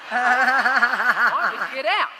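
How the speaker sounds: slow, loud laughing line. Real, not acted. Host imagining walking out, laughing slowly. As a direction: drag it out through laughter. Joking about leaving the room.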